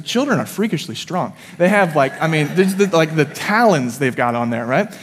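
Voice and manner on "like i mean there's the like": usual speaking voice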